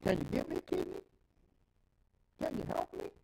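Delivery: high, whiny begging voice; mock pleading. The sinner begs God for a kidney, high mock whine. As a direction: whine it like a desperate beggar.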